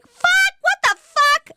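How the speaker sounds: high-pitched exasperated outburst; retold outrage. Viewer retelling her reaction to the twist, high outraged cry. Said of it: "I was enraged".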